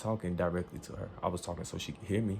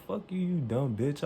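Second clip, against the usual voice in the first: higher, slow, drawn-out insult; angry retort; 6 semitones higher, slower than usual. Retold or imitated. Banned customer answering the clerk back, angry retort. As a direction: stretch out the insult in defiance.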